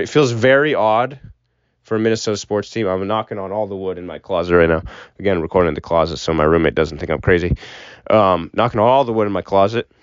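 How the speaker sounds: usual speaking voice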